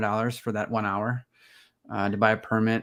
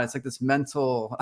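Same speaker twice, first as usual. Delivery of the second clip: ordinary talk, nothing marked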